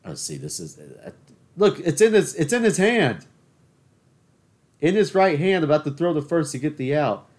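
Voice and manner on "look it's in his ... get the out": high and loud, pointing out the error; exasperated fan yell. Real, not acted. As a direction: shout at the screen in disbelief. Yelling at baseball on TV.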